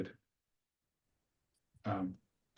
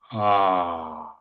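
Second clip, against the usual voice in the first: a long, louder, open ah held steady; toned ah; 4 semitones lower, 5 dB louder than usual. Acted. Breathwork leader toning a long ah with the group. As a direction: sound the ah from the base up to the crown. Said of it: "just make the R sound".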